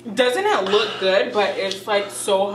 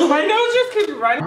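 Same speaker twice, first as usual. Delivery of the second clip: laughing; 8 semitones higher, 3 dB louder than usual. Real, not acted. Runny nose from spice, laughing.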